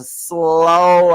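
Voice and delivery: "slow" stretched long on its vowel; dragged-out word. Shown as a demonstration. Speaker showing viewers their accent is kinda slow, dragging it. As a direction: drag out "slow" to show the drawl. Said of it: "I kinda drag my words".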